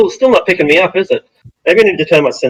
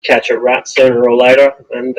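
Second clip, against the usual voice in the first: usual speaking voice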